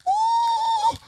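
very high "ooh"; preacher's whoop. Real, not acted. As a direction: let out a high exclamation. Preacher's exclamation.

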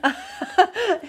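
mock-puzzled, giggling at the made-up word; laughing disbelief. Retelling a restaurant argument with a friend.

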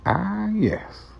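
Morgan Freeman voice. Shown as a demonstration. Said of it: "in the end".

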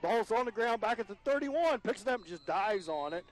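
real jolt of alarm in the call; alarmed excited call. Real, not acted. Announcer reacting to a snap over the head, alarmed.

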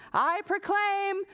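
slow, high proclamation; cheering. Acted. Pearl saluting the squirrel mother, grand slow cheer. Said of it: "cheered Pearl".

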